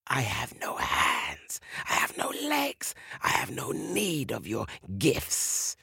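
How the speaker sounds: slow, hissing whispery serpent; haughty snake king. Acted. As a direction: draw it out with whispered disdain. Snake king scorns the gift.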